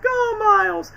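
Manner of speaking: high, loud call; urgent shout. Retold or imitated. Gamer imitating Peter calling out to Miles, high shout. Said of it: "then Peter's like".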